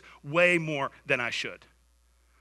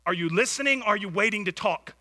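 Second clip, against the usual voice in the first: usual speaking voice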